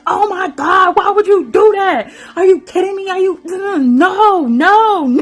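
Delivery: high, loud exasperated yell; frustrated viewer yelling at a dumb character. Retold or imitated. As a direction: shout at the screen in disbelief. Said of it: "you yelling at the T V like".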